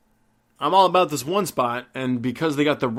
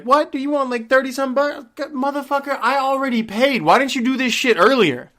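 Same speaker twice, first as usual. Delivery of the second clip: high, loud angry squawk; incredulous outrage; 10 semitones higher, 4 dB louder than usual. Retold or imitated. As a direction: blow up at the salesman in disbelief. Retold outburst at pushy salesman.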